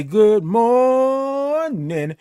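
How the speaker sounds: high, long-held "morning", stretched on the first syllable; big high stretched-out morning greeting; drawn-out greeting. Real, not acted. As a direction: sing out "good morning", holding "mor-" very long. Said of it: "I love all them O's and R's and I N Gs".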